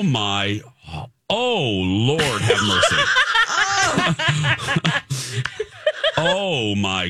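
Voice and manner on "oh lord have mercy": laughing. Real, not acted. Overwhelmed by the scene.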